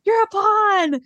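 hammed-up screamed accusation, not full volume; Jax screaming. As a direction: imitate a high-pitched insult yell. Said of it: "Jax is just screaming".

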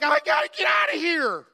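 very high-pitched, loud yell; panicked mock shout. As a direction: act out a kid freaking out and fleeing. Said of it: "I am freaking out".

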